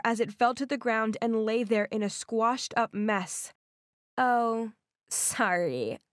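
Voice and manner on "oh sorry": sarcastic. A narrator's reading. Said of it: "a girl said in a sarcastic tone".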